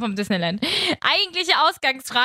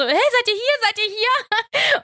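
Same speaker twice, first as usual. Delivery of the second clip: high, drawn-out calling into the house; 10 semitones higher, slower than usual. Retold or imitated. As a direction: call out into the empty rooms. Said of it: "habe so".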